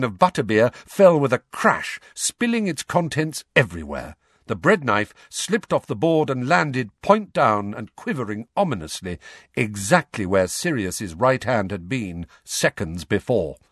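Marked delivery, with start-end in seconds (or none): none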